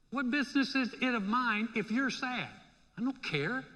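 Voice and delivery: acted uncaring prisoner; mock indifference. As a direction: brush them off like it's none of your business. Acts out an uncaring fellow prisoner.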